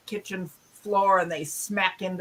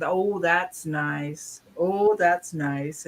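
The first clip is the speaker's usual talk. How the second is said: slow, drawn-out sugary "Oh, that's nice"; polite words hiding contempt, said slowly; dry polite brush-off; 3 semitones lower, much slower than usual. Retold or imitated. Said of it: "the third lady kept saying".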